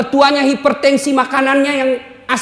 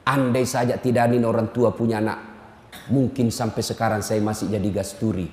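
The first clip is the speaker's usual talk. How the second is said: mock pious prayer; 13 semitones lower, 6 dB quieter than usual. Preacher voicing a wife thanking God for her mother-in-law, hushed mock devotion. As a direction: lower and softer, a hammed-up humble prayer.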